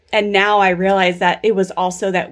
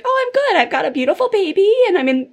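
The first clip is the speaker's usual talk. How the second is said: pitched way up in a breezy fake-fine voice; mock cheery; 8 semitones higher than usual. Mom mocking her old public front to the host, high and falsely bright. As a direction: play the mom pretending all is well. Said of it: "I was like".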